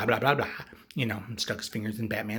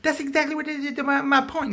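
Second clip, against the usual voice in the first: very high, loud sputtering rant; hammed-up indignant stammer; mock outrage; 6 dB louder than usual. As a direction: argue back in a worked-up squeal. Acts out Joker's escalating quarrel.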